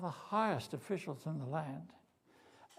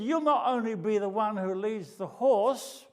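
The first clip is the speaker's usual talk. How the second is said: loud, high furious command; angry king; 7 semitones higher, 9 dB louder than usual. Acted. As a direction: lose your temper and order him. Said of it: "finally lost his temper".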